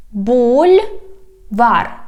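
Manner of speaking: "Бульвар" slow, split in two syllables. Shown as a demonstration. Teacher teaching street words, "Бульвар" slowly by syllables. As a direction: stretch "Бульвар", pausing between the two syllables. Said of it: "divide it into syllables".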